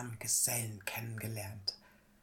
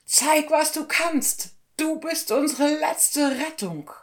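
very high, loud desperate plea; hunter's high-pitched cry for help; urgent call; 8 dB louder than usual. A narrator's reading. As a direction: call out for last-second rescue. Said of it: "rief der Jäger in letzter Sekunde".

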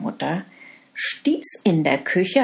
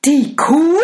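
shocked, much louder cry; startled husband; 9 semitones higher, 9 dB louder than usual. Acted. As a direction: gasp the question in alarm. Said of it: "rief der Mann erschrocken".

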